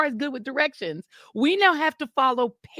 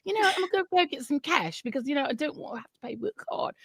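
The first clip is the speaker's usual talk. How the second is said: softer mock British excuse; fake British accent; 4 semitones higher, 6 dB quieter, faster than usual. Disguised shopper excuses herself to get cash, soft British accent. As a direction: make excuses in put-on British. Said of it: "speaking in a fake British accent".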